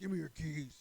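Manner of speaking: imitated shouted order; retold angry demand. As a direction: demand it like a cross uncle. Retold uncle demanding the keys.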